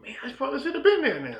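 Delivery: high-pitched mock lament; hammed-up high regret; regretful absent dad. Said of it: "LeBron James' daddy".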